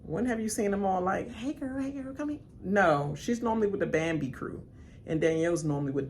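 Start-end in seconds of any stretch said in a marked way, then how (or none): 1.3-2.4 mock girly greeting. Mimicking friendly girls.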